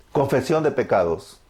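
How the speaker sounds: ordinary talk, nothing marked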